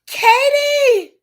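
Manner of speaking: high, loud cry of joy; joyful shout. Real, not acted. Streamer shrieking with joy at a viewer's good news. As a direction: burst out shouting her name in delight.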